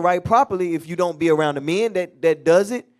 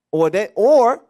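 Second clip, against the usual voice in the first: much louder, higher cry; preacher calling out to the church; loud outburst; 6 semitones higher, 10 dB louder, slower than usual. Real, not acted. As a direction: burst out loud to grab attention. Preacher builds to forceful point.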